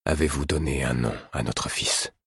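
low, soft, hoarse and broken; king asking his wife the child's name, hoarse; hoarse and halting. A narrator's reading. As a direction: ask haltingly through a rough throat. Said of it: "D'une voix à la fois rauque et hachée".

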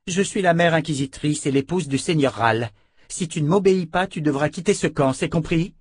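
stern threat. Acted. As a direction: lay down the law. Callan threatens Rika with expulsion.

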